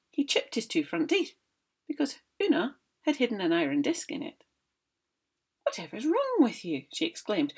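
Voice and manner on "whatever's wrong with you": higher, mock-astonished wife; feigned surprise at the giant; hammed-up wifely exclamation; Una's voice. Una voiced in story.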